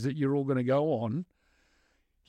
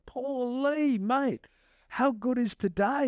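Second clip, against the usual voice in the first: put-on high, beaming kid's voice; cheery kid; 9 semitones higher, slower than usual. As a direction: lift it up high and bright like the smiling boy. Aldo kid quoted cheerfully.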